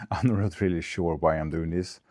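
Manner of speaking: ordinary talk, nothing marked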